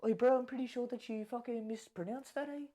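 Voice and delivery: high, hammed-up New Zealand accent; Kiwi accent. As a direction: tease like a Kiwi bro. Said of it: "I've been doing this accent".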